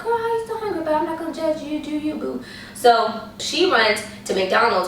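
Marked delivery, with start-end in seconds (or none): 0.0-2.4 high, sassy and teasing, soft at the end; sassy tease. Retold or imitated. Teasing the still-hungry friend, high sassy voice.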